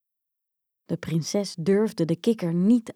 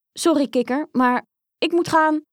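higher, louder princess voice; hurried excuse; 8 semitones higher, 5 dB louder than usual. Acted. Princess excusing herself to the frog, hurried excuse. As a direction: apologise up high, eager to get away.

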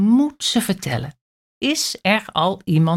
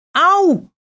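high, loud ouch; ouch cry; 11 semitones higher, 6 dB louder than usual. A narrator's reading. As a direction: yelp after bumping. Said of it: "roept ze". (Kleine Aap roept hoog auw)

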